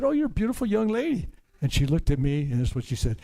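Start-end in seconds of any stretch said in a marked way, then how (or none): 0.0-1.3 high, lilting mock-sweet compliment. Older lady sweetly compliments girl at convenience store, high and lilting. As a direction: coo it like a doting older lady. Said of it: "and I, I said".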